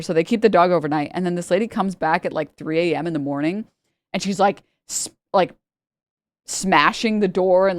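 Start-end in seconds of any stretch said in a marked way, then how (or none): none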